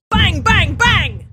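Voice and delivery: very loud, high imitation of banging; shouted banging. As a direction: bellow the bangs, each starting high and falling. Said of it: "and the dwarf goes".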